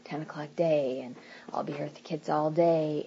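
soft, weary whispered grumble; discouraged whisper. Retold or imitated. Wife dreading another late workday, whispered complaint. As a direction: sigh it out under your breath. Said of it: "if I'm feeling discouraged".

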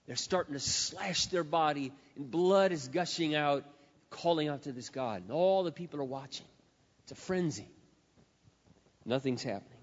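ordinary delivery

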